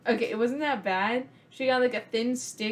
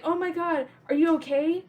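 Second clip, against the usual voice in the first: high, anxious "are you okay" in a retold kid's panic; imitating a scared child checking on her brother; panicked kid; 7 semitones higher, 4 dB louder than usual. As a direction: fuss over him in a mock panic. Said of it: "So I'm just like".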